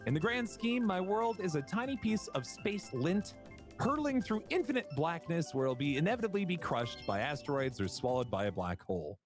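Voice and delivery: high, measured inspirational recital; affirmation reading. Acted. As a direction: deliver a lofty mock affirmation, climbing at the end. Reads words of encouragement.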